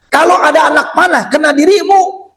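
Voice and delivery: high-pitched urgent plea; urgent devotion. Acted. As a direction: cry out in protective concern for a beloved master. Acted devoted servant protecting the Prophet.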